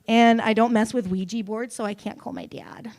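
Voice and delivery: ordinary delivery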